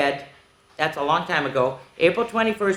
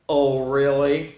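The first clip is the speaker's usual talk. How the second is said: low, slow, drawn-out doubt; mock scepticism; 4 semitones lower, slower than usual. Reader doubting praise of the kids, slow scepticism. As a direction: drag it out lower, unconvinced.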